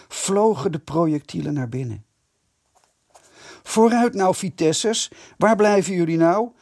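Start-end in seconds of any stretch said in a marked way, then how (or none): 3.6-6.5 high, loud jeering call; taunting shout. A narrator's reading. Besiegers taunt the Vitessers inside, loud jeering. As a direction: yell a dare at the hiding boys. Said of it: "schreeuwden de belegeraars".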